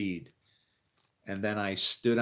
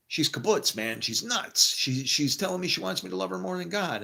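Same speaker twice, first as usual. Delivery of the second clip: rising higher and more worked up as he goes; imagined call to the rabbi, hammed-up exasperated complaint; excited, exasperated; 7 semitones higher than usual. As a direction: pretend phone call, venting to the rabbi in a high pitch. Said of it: "call a rabbi on a phone at that time and go".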